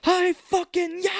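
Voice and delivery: high, loud and slow martial arts cry; drawn-out karate yell. Retold or imitated. Quoting Cage in Deadfall, a slow high karate yell. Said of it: "he does the".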